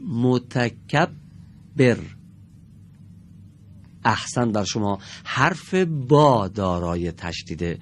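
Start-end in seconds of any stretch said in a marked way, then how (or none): 0.1-2.0 متکبر drawn out very slowly, the doubled ب held; slow demo word. Shown as a demonstration. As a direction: read متکبر slowly for the class. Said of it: "دقت کنید".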